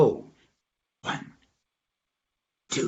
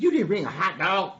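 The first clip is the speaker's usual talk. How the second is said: higher-pitched questioning voice; donkey asking; 5 semitones higher than usual. Acted. Donkey quizzes dog about food, higher questioning voice.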